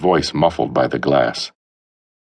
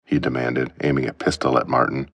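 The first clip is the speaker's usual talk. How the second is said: ordinary delivery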